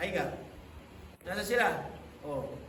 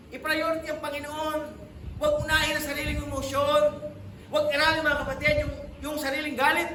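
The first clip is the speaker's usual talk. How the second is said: louder, higher exhortation; forceful preaching; 6 semitones higher, 5 dB louder than usual. Acted. Pastor warning members against their own anger, in forceful preaching. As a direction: press it on the flock.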